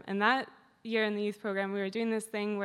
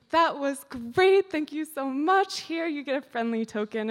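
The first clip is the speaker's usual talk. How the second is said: mock delighted praise; 5 semitones higher, 5 dB louder than usual. Woman suddenly cheerful, praising.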